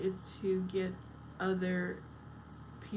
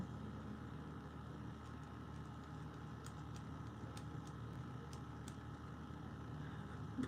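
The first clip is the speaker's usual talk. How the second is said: long, soft breathy laugh; laughter; 14 dB quieter than usual. Real, not acted. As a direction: laugh quietly for several seconds. Laughs at a chat comment.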